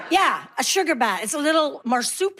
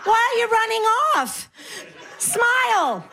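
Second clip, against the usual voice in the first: mock mishearing old lady, climbing higher and laughing; pretend deaf granny; 4 semitones higher than usual. Woman called granny, pretending she's deaf, higher and laughing. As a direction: play a deaf granny who mishears everything, getting higher. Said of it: "what I do is I pretend I'm deaf".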